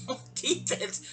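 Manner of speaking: breathy laughing echo of On defense, on defense said through a laugh, slow; repeating On defense to Allie with a laugh. Real, not acted. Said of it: "I'm on defense".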